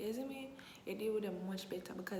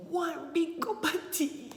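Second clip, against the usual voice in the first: louder and higher, stretched out, outraged; outraged exclamation; 10 semitones higher, 9 dB louder, slower than usual. Retold or imitated. To a friend, outraged about the huge cup of tea, said loud and high. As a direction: blow up over the size of the cup.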